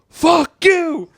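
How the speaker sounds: a father finally screaming at his son; shout. Retold or imitated. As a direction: blow up at the kid. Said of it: "turn the volume down on that".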